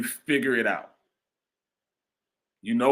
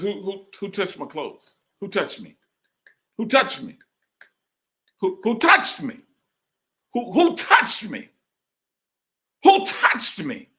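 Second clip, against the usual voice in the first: rising, louder voicing of Jesus searching the crowd; Jesus asking; 9 semitones higher, 4 dB louder than usual. Preacher voicing Jesus searching the crowd, building louder. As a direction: call out again and again, higher each time.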